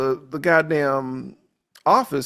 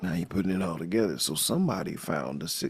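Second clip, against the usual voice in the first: ordinary talk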